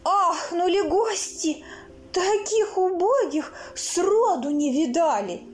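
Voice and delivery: usual speaking voice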